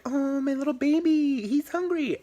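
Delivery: put-on high cooing baby talk. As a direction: coo to it like a tiny baby. Cooing over a virtual pet.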